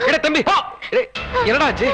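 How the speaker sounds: laughing. Acted. Elder laughs, asking brother what happened.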